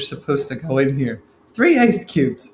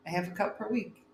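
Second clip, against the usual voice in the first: normal talking voice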